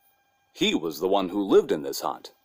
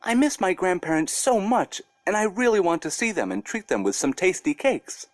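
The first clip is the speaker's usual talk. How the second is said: put-on high little girl voice, sweetly pleading; a small girl coaxing a bear to let her go; little girl Masha; 7 semitones higher than usual. Masha begging the bear to visit grandparents, high sweet girl voice. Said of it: "and said to him".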